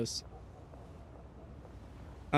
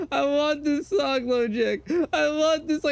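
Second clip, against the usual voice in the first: put-on slow, higher slurred drunk voice; drunk demanding; 10 semitones higher than usual. Drunk narrator demanding a song from [name], slow slurred whine. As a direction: whine it like a wasted friend. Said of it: "bro, shit-faced drunk".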